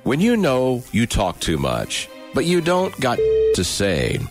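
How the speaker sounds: ordinary talk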